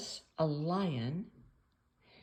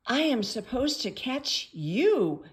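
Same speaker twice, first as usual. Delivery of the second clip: lightly voiced cat, higher and louder; a hint of a meowing cat, raised voice; 6 semitones higher, 6 dB louder than usual. A narrator's reading. Storybook cat confronting the mouse it should catch, lightly meowed. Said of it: "meowed the cat".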